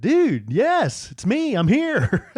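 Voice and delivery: loud, high, laughing reply; excited. Retold or imitated. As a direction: burst out happily, laughing. Excited reply to old friend.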